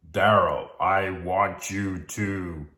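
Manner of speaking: slow, deliberate mock voice of God; booming Hollywood God. God sending Daryl on an errand, mock-grand. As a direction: play God grandly issuing orders. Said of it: "the tone of my voice, like".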